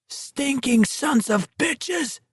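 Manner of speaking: very high, slow, strained shriek. Acted. Walker shrieking curses at state troopers, high and strained. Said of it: "Garrity shrieked at them".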